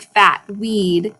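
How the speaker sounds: ordinary delivery, nothing marked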